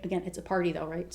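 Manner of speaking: ordinary delivery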